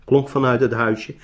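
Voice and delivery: plain reading voice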